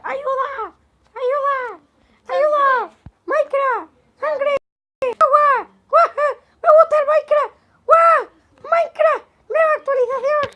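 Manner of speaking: put-on very high shrill child screams, loud; screaming kid. Imitating a kid who got hurt, screaming for help. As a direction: shriek like a hysterical little kid. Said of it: "Empezó a gritar".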